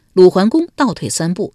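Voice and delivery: usual speaking voice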